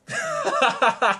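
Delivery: cracks up high; real laugh at the opponent's Burning Abyss deck; high burst of laughter. Real, not acted.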